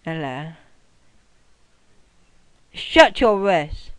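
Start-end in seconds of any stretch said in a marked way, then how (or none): 2.7-3.9 loud, slow angry yell; slow furious gamer shout. Real, not acted. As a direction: bark it at the screen. Gamer yelling at game character.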